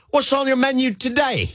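high, bright Aussie question; Australian accent. Retold or imitated. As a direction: ask it like an Aussie reporter. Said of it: "Can I do this in an Australian accent?".

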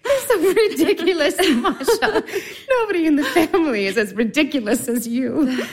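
high giggling teasing; laughing. Acted. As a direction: tease her through laughter. Sister laughing at Masha.

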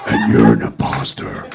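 fake [name]. Comic imagines crashing the interview as Gene, deep growl. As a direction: growl like the Demon himself. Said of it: "walk in the middle of his interview and go".

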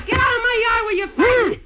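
shout. Acted. Woman yells at men fighting.

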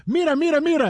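playful sing-song mira; mock Spanish calling out; retold Spanish calling. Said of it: "And I said".

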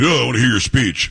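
lightly mocking, snotty senator voice. Host imitating a senator cutting off a speech, snotty. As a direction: imitate a snotty objection, a little put-on. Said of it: "gonna get all snotty".

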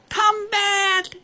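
very high, held-back distant shout. A narrator's reading. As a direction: call someone back from afar. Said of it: "though she shouted".

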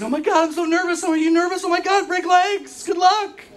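put-on high, excited girly chatter; nervous girls. Girls nervous backstage before the recital, high girly voice. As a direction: gush like a jittery little dancer. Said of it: "The girls are nervous backstage".

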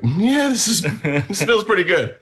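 acted approving verdict. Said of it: "and we're like".

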